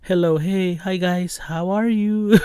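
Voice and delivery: put-on high, slow, sweet greeting like a nice naive new kid; overly polite newcomer. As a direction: greet everyone too nicely. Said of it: "'cause before I'm just like".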